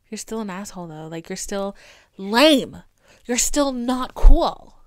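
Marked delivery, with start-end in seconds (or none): 2.2-4.8 emphatic scold. Real, not acted. Scolding Elon.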